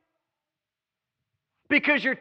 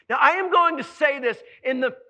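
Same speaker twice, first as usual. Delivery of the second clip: usual speaking voice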